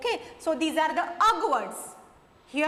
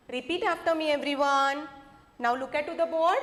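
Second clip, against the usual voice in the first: ordinary talk, nothing marked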